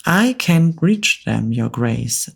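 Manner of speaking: ordinary delivery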